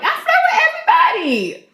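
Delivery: squeaky high playful protest; high-pitched mock innocence; high mock flirty voice. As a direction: pitch it way up, teasing. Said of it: "I'm like".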